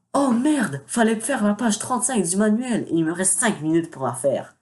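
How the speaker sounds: a high, hammed-up "oh merde" of sudden alarm; mock panic. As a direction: play a schoolboy who just remembered his homework. Acted homework panic, joke.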